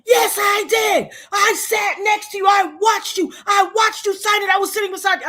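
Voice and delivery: high, shouted insistence down the phone; yelling. Retold or imitated. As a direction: imitate a woman hollering her accusation. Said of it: "she's on the phone yelling".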